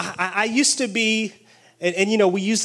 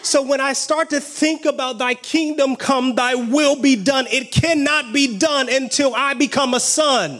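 raised, intense preaching voice; fervent preaching; 5 semitones higher than usual. Real, not acted. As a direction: preach it with fire to the congregation. Preaching at full force.

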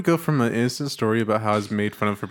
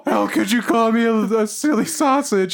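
much higher, louder anguished shriek; freaking out; 12 semitones higher, 5 dB louder, much slower than usual. Retold or imitated. Sketch guy called a silly sausage, shrieking in hammed-up despair. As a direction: wail it like the insult wounded you. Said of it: "freaking out and being like".

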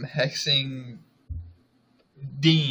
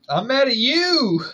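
high, loud playful outburst; mock anger; 12 semitones higher, 8 dB louder than usual. Co-host throwing the accusation back, mock angry. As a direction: shout it back in pretend outrage.

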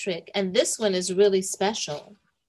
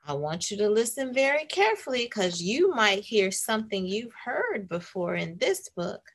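plain reading voice

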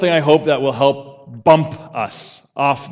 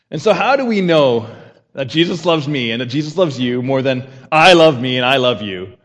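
usual speaking voice

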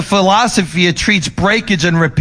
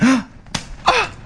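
sharp startled gasp at a broken dish; mock gasp; 8 semitones higher, 5 dB quieter than usual. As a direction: gasp as if it just slipped.

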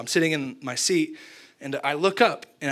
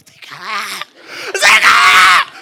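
excited fans yelling his name, second call much louder; mock fan shout; 3 semitones higher, 9 dB louder, slower than usual. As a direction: call out like a starstruck crowd. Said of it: "They're like".

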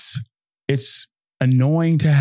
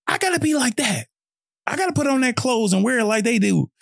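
much higher, starry-eyed wannabe; admiring copycat voice; 10 semitones higher than usual. Retold or imitated. Someone envying another's greatness, high starry-eyed gush. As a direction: gush like you want to be them. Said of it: "We're like".

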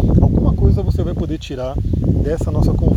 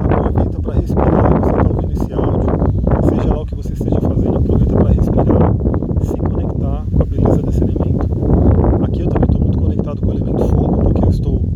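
wind on mic; 6 dB louder than usual. Real, not acted. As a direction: wind gusting over the microphone. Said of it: "vocês devem estar ouvindo o vento aí".

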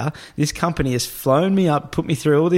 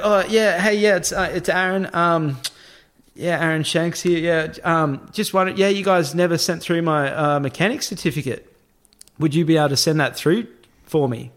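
higher-pitched pretend phone voice; fake phone call; 5 semitones higher than usual. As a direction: act casual on a made-up call. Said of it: "I'm having this fake conversation".

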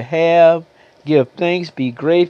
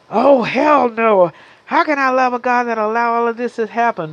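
higher-pitched, mock-outraged protest; indignant refusal; 6 semitones higher, faster than usual. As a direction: drag out the refusal, then rush the protest. Acts out angry refusal of believer.

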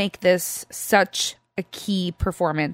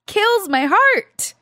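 Kills my heart, higher and drawn out; tearful emphasis; 10 semitones higher, slower than usual. Real, not acted. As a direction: let the repeat break with feeling. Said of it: "I'm, like, tearing up right now".

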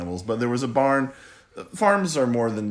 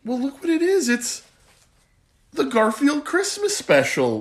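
delighted opening a present; 14 semitones higher, 4 dB louder than usual. Pretend. Dad unwrapping present, feigned delight.

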